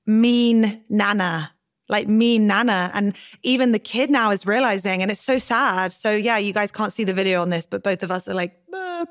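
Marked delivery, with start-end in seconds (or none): 0.1-2.9 slow, stretched "mean nana"; drawn-out name. Retold or imitated. Quoting child's name for grandma. 8.7-9.1 very high, soft pitying "ah"; a soft pitying sigh of sympathy; sympathetic sad "ah". Acted. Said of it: "pearly sad faces".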